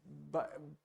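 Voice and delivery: ordinary talk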